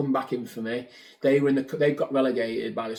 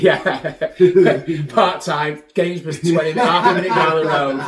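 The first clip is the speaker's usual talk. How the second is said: laughing pitch; 3 semitones higher, 10 dB louder than usual. Retold or imitated. Chairman's laughing phone pitch.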